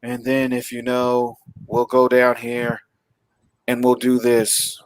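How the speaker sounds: slow, monotone tour-guide patter; bored villain narrating a plan. Retold or imitated. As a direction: drone through it with no interest, pausing between phrases. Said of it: "He sounded like a bored tour guide".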